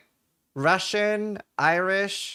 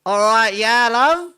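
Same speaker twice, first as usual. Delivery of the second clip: put-on much louder, higher Australian accent; Chris Hemsworth Australian accent; 6 semitones higher, 10 dB louder, slower than usual. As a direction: belt out a hammed-up Australian accent. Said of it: "Chris Hemsworth clip where he's like".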